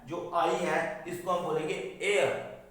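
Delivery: ordinary delivery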